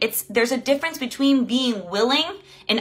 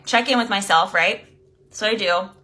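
usual speaking voice

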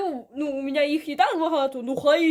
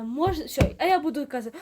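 ordinary delivery

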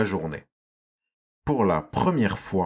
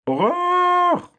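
cri d'effroi très aigu et fort; s'exclamer d'horreur, la voix qui monte très haut; horreur; 6 dB louder than usual. A narrator's reading. Narrateur découvre le chasseur. (le narrateur s'effraie de voir le chasseur, cri aigu)

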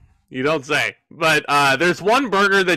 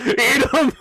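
high mock order through a laugh; hammed-up Lashley command; 8 semitones higher than usual. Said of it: "Bobby Lashley looks at you and says".

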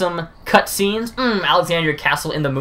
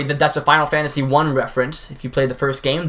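ordinary delivery

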